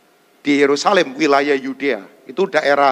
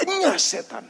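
Enyah setan pitched much higher, sharp; stern rebuke; 7 semitones higher, 5 dB quieter than usual. Acted. Jesus turning on Peter, pitched-up stern rebuke. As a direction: lift Enyah setan up high with force. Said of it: "dan berkata kepada Petrus".

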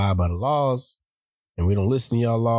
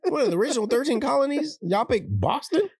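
high, laughing disbelief; incredulous. Real, not acted. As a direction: squeal it in disbelief. Incredulous mock outburst.

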